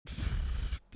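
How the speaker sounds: long breathy tired sigh. Real, not acted. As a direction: let out a weary sigh. Tired after yawning.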